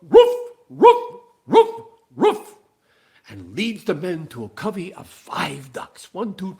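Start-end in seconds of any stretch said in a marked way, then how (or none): 0.0-2.5 loud high barks; sharp high yelps, full voice; dog barking. Acted. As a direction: bark like an eager dog. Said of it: "he goes".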